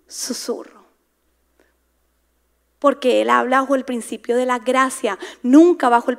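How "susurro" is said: breathy whispered word. Shown as a demonstration. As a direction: show the whisper on the word itself. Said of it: "cuando Dios habla es simplemente".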